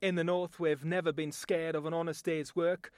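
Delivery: blunt Northern English accent; a proud Northerner scorning Londoners. Shown as a demonstration. A Northerner boasting about hard work, in a Northern English accent. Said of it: "my voice talent demonstration".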